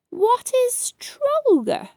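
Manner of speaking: high, slow eerie goblin question. Acted. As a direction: draw it out strangely, like a goblin. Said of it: "asked with an eerie voice".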